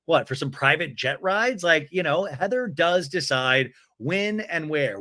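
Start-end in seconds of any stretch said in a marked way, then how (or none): none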